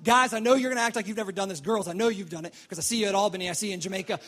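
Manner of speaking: ordinary talk, nothing marked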